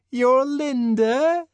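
high, loud, coquettish voice; an aging woman clinging to hope; pleading Linda. Acted. Linda reminding the director who she is, high and eager.

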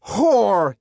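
high, loud bellow of rage; furious shout. A narrator's reading. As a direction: hurl it as a shouted insult. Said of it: "he shouted".

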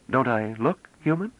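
high female character, careful diction; careful foreign speaker, a trifle halting; slightly hesitant. A narrator's reading. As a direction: strain a little to pronounce it right. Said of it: "with just a trifle of hesitation".

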